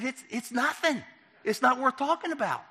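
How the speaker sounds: high-pitched, protesting brush-off; mock-innocent downplaying; retold dismissal. Brushing off the FBI's question.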